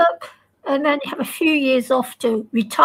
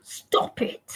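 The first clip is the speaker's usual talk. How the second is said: breathy whisper, barely voiced; 9 dB quieter than usual. Real, not acted. Whispering to friends to stop it. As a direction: hush them with a sharp whisper.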